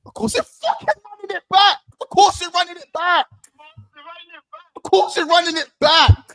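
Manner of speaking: high, loud, jubilant shouting; celebrating club news at full volume; excited shouting. Real, not acted. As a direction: yell it out in pure joy.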